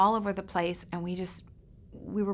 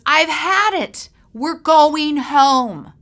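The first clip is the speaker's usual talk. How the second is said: loud, slow and higher, at the end of his rope; fed-up father; 7 semitones higher, 11 dB louder, much slower than usual. Retold or imitated. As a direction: lay down the final word to the kids. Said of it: "he breaks and he says".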